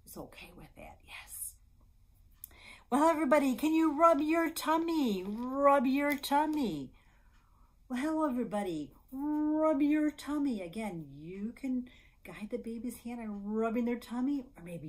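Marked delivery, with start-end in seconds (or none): 0.1-1.6 much softer, near whisper; hushed aside. Real, not acted. Librarian reassuring parents about eye contact, hushed. 2.9-10.6 high, loud, playful chant; baby game singsong. Shown as a demonstration. Librarian leading babies to rub tummies, playful singsong.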